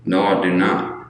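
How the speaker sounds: ordinary talk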